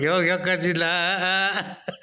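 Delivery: slow words broken by real laughter; drawn out and laughing while teasing. Real, not acted. Laughing at the boy's face.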